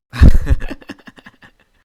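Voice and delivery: loud burst of laughter. Real, not acted. Laughing at binocular joke.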